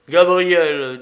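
exasperated elder calling to order; irritated mentor. A narrator's reading. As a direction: call the name out in annoyance. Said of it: "d'un ton de menteur irrité".

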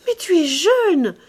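loud, high outburst of loving protest; passionate exclamation. A narrator's reading. Woman protesting to her older lover, passionately. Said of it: "s'écria-t-elle de nouveau avec un emportement de passion".

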